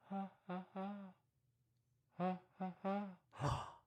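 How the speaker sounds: soft far-off ha ha hoots; faint monkey answering from afar; distant monkey call. Pretend. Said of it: "What's that in the distance?".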